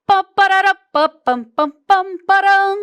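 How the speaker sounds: loud, high mock fanfare sung out on pam; herald's trumpet fanfare. Shown as a demonstration. Herald imitating a trumpet before the king's announcement. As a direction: blast the royal trumpet call with your voice. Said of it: "E esse arauto tá lá".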